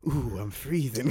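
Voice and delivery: slow, shivery mock complaint; shivering plant voice. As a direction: play a cold little plant, slowly. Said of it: "a plant being like".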